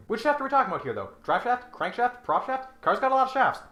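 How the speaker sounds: rising indignant bewilderment; exasperated rant. Real, not acted. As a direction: demand an answer, baffled. Ranting about shafts.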